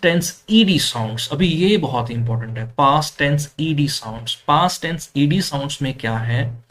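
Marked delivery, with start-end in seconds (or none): none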